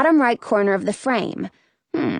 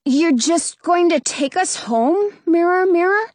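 put-on higher-pitched boy's question, mildly let down; slightly deflated questioning, pitch lifted; a little disappointed; 7 semitones higher than usual. A narrator's reading. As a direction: ask it with a touch of disappointment. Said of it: "sounding a little disappointed".

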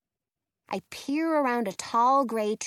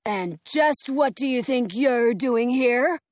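slow, louder guard challenge; suspicious guard; 5 dB louder than usual. A narrator's reading. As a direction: challenge the intruders slowly. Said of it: "Then he adds".

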